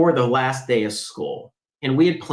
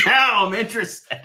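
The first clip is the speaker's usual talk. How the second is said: higher and slower, still laughing; Now I'm interested, pitched up and drawn out amid laughter; laughing repeat; 9 semitones higher than usual. Real, not acted. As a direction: savour the line through laughter.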